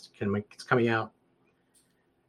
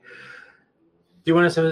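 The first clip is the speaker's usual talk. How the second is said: mock rattled-off agent; 9 semitones higher, 9 dB louder, much slower than usual. Mimics rushed phone agent.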